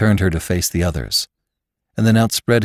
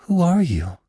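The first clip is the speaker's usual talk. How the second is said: ordinary delivery, nothing marked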